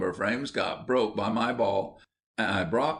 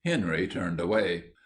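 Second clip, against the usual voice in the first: ordinary delivery, nothing marked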